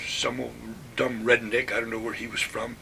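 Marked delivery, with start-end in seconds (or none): none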